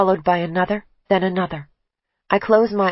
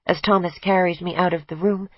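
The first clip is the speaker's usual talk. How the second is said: plain reading voice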